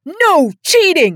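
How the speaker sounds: high, loud furious screech; high screaming outburst. A narrator's reading. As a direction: shriek it at her in rage. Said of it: "the hunter screeched".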